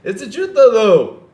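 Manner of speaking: high, loud put-on protest; mocking imitation. Mimicking how the Dreamcast guy talks, high insistent protest. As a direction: hammer the last word loudly in a high mock voice. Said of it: "That's how he talks".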